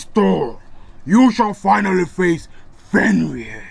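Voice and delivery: loud, slow menacing beast voice; growling wolf man. Acted. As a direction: sniff out your prey, threatening. Wolf man challenges Thor.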